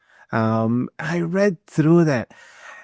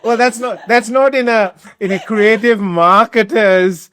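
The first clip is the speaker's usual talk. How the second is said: high laughing protest, words half-laughed; 9 semitones higher, 7 dB louder than usual. Real, not acted. Host laughing while teasing the guest about his marketing. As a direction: laugh through the words, amused disbelief.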